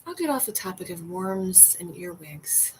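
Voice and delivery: usual speaking voice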